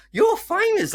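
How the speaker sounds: very high, loud mock squeal; imitation of a gushing admirer, far up in pitch; starstruck fan.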